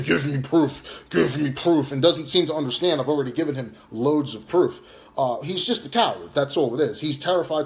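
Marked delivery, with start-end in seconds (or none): 0.0-1.9 slow, drawn-out mocking repeat; mocking whiny demand. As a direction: parody the guy who keeps asking for proof. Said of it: "that keeps saying".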